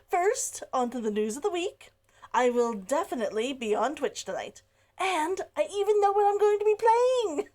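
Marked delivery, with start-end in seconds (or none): 5.0-7.5 excited tease. Real, not acted. Excitedly teasing her stream game.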